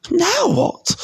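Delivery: high, cross woman's voice; high-pitched exasperated aunt; furious aunt. A narrator's reading. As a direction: demand it in a high, irritated pitch. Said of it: "looking furiously at Harry".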